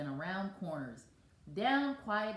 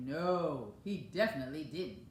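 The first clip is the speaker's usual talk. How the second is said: lower, quick, mock-fierce refusal; a mildly scary no from a setter; understated terrifying snap; 4 semitones lower than usual. A narrator's reading. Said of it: "in a terrifying tone of voice".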